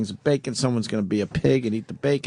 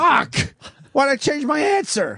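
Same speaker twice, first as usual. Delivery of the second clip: high, loud frustrated outburst; frustrated yell; 12 semitones higher, 3 dB louder, slower than usual. Real, not acted. Frustrated at losing the guess.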